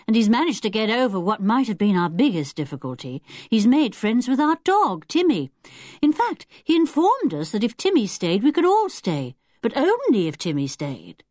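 lightly amused, animated telling; cheerful Julian. Acted. Julian tells Missus Lehman about Timmy, cheerful and animated.

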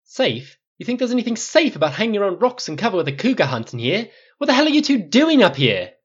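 high, loud, incredulous shouting; outraged snort. A narrator's reading. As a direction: tear into them in fury. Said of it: "Flynn's snort was more than slightly similar".

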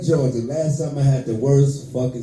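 ordinary talk, nothing marked

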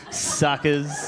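low snickering, then drawn-out "suckers"; sly mock triumph; gloating. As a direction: gloat as if you got away with it. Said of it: "I walk through and go".

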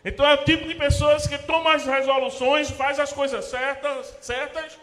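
high, loud pulpit preaching; full-force preaching. Acted. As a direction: preach it out to the congregation. (pregação inflamada, alta e forte)

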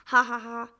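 loud, spelled-out ha-ha-ha; mocking laugh. A narrator's reading. Shaggy old man laughs at the doctor, mocking. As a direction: laugh scornfully, punching each ha. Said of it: "lachte der zottige Alte".

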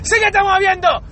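hammed-up Spanish scolding. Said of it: "now she just prolonged the fucking sentence".

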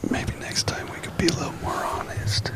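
hushed sultry whisper; ASMR whisper. Pretend. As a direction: breathe the words softly. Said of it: "a little A S M R".

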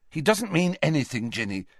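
quick reflex reassurance, pitch lifted; smooth it over without thinking; automatic placating. Acted. Harry stepping in between Ginny and Ron, automatically. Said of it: "said Harry automatically".

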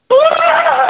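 loud, high-pitched bawl; retold crying wail. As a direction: burst into an exaggerated wail. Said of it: "I started crying".

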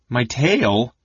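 startled, questioning thought; mildly incredulous; a touch of bewildered panic; wild disbelief. A narrator's reading. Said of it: "Tass thought wildly".

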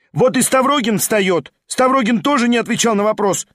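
outraged student; accusing shout. A narrator's reading. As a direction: point him out to everyone. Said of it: "крикнула студентка".

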